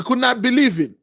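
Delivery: usual speaking voice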